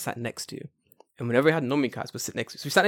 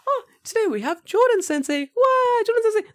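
very high-pitched impression of a teacher; 3 dB louder than usual. Teacher greeting Jordan Sensei, imitated very high voice. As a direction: squeal it like a delighted little teacher. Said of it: "That's how she talks".

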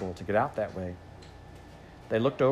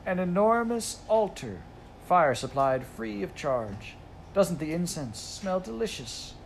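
complacent; 7 semitones higher, slower than usual. A narrator's reading. Phoenix gloating over burning theater.

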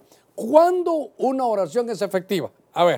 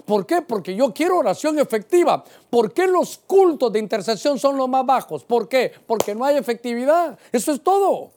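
insistent, raised preaching voice; forceful preaching; 5 semitones higher, 3 dB louder than usual. Real, not acted. Preacher urging the congregation towards effective prayer, forceful. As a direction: drive each answer home, rising high at the end.

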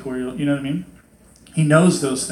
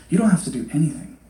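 ordinary talk

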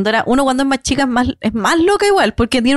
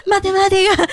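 high-pitched mocking squeal; mock gushing; 9 semitones higher than usual. Student mocking maths lovers, high gushing squeal. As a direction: squeal it in fake delight.

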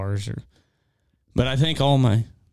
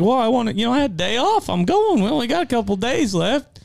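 mock defensive dad; 11 semitones higher than usual. Dad to son caught duck hunting, mock high sheepish excuse. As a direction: play it up as a guilty dad excusing himself. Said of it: "And I was like".

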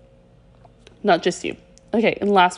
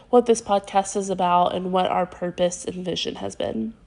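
normal talking voice

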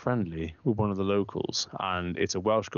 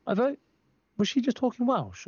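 high, mock-innocent wife; high-pitched mock wife. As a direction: squeak it out like the wife asking. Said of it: "she goes to me".

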